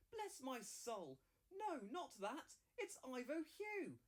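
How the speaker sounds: stunned to see his own name; astonished. Acted.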